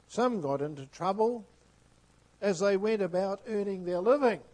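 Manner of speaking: loud, rising sermon voice; fervent preaching. Acted. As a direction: preach it to the back pew. Preaching at full force.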